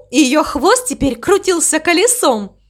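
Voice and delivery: ordinary delivery, nothing marked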